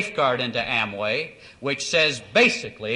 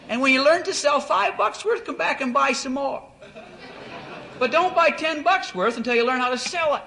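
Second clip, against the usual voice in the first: animated lecture; 13 semitones higher than usual. Retold or imitated. Lecturing a rich caller on how to join, high and emphatic. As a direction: drive the point home, high.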